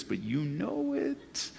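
ordinary talk

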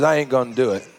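a hint of Arkansas accent, pitched low. Preacher quotes Jesus refusing the Pharisees, light Arkansas accent. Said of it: "talking in an Arkansas accent".

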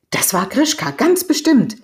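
high, loud burst of conviction; emphatic certainty. A narrator's reading. Kia recognising Grischka in the hunter's tale, exclaiming. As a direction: exclaim it, leaping up on the last words.

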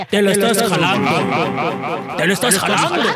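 slow echoing voice of God, scolding; God's echoing voice. Retold or imitated. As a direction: boom down from heaven, half-joking. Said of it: "le decía". (Dios regañando con eco, en broma)

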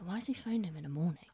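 fast, hushed whisper; suspicious whisper. Retold or imitated. Suspicious inner thought.

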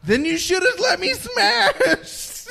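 high, slow, laughing taunt; laughing tease. Pretend. Friend teasing back after mom joke, laughing high taunt. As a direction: squeal it out through laughter.

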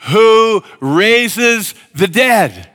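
slow, loud, drawn-out congregational reading; slow unison scripture reading; read aloud in unison. Shown as a demonstration. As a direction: read each word slowly together with the crowd. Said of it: "I want you to say it with me".

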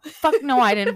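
loud, high burst of disbelief; emphatic denial. Real, not acted. Wife rejecting husband's denial, loud and emphatic.

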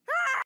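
sudden loud high scream. Acted. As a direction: shriek in shock. Character screams.

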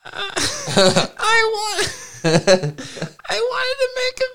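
high laughing squeal; breaking up with laughter. Real, not acted.

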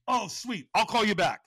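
high, loud retold sign-off; delighted buddy. Buddy hears friend is free, delighted. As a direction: imitate a thrilled friend hurrying off the phone.

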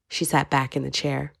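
usual speaking voice

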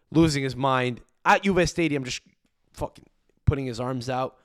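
ordinary delivery

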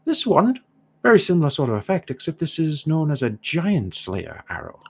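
a merchant's Irish pitch, leaning in to sell; dwarf shopkeeper in an Irish accent, showing off a new arrow. Acted. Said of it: "I've gone entirely Irish now".

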